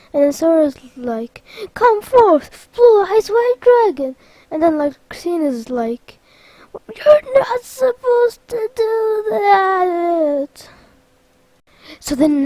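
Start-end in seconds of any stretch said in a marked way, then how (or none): 1.8-4.2 high, loud monster call; battle summons. Acted. Sora answering Larxene's challenge, loud summons. 6.7-10.7 high, slow, drawn-out wail; whining protest. Acted. Larxene protesting Sora's summons, wailing complaint.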